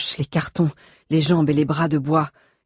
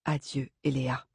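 murmured farewell; 2 semitones lower, 9 dB quieter than usual. A narrator's reading. Brain saying goodbye to Eléa in the fire, soft murmur. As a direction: murmur it quietly, fading away. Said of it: "murmura Brain".

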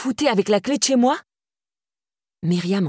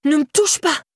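cried out; 9 semitones higher than usual. A narrator's reading. Laura pushed off balance by Myriam, crying out. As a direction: shriek it as she shoves you. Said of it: "s'écria Laura".

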